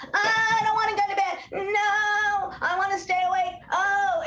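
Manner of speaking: put-on high, sing-song whining child; mock toddler protest at bedtime; whiny tantrum. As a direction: wail it like a kid refusing bed. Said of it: "I'm gonna tantrum".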